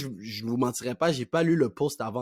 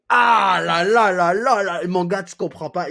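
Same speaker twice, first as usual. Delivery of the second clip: loud, high, stretched "Ah là là"; dramatic exclamation; 5 semitones higher, 9 dB louder, slower than usual. Shown as a demonstration. Friend teases gossip he may withhold, loud dramatic exclamation. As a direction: wail it out, dragging each "là". Acted juicy gossip reaction.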